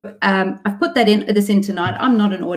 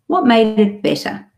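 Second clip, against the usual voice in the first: normal talking voice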